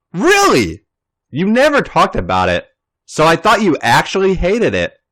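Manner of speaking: a hint of delighted disbelief; pleased surprise. Natalia learning her painting was liked, pleasantly surprised. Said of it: "Natalia smiles".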